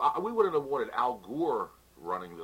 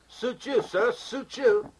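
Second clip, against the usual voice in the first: comedy catchphrase voice; 10 semitones higher, 7 dB louder, much slower than usual. Retold or imitated. Catchphrase impression.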